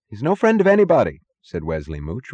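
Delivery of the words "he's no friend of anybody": high, loud, fast interjection; regaining confidence. A narrator's reading. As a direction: jump in eagerly with new confidence. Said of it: "recapturing a shade of confidence".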